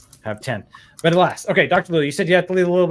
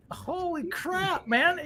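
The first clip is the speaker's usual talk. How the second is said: high, slow, hushed in disbelief; stunned awe; 11 semitones higher, 6 dB quieter, much slower than usual. Real, not acted. Host stunned by the Toyota news, hushed awe. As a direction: let the awe drain the breath out.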